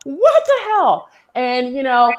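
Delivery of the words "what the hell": much higher, drawn-out shocked cry; shocked what the hell. Retold or imitated. Woman in a club, hit on by French guys, shocked and high. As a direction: recoil in disbelief, slowly. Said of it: "I'd be like".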